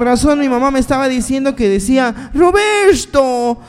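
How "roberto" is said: slow, high drawn-out call of a name; mock mother calling out at night. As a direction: call him like a worried mother.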